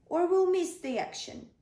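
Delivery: high, loud urging. Acted. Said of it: "said David".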